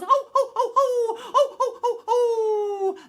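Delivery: very high, loud laughing yelps; wolf yelping ho ho. Acted. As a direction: yelp while running off. Scalded wolf runs away.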